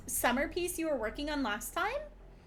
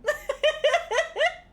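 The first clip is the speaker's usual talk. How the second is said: high squealing laugh; 13 semitones higher, 7 dB louder than usual. Real, not acted. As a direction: giggly squeal. Laughs at own joke.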